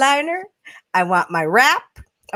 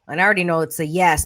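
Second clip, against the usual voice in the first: normal talking voice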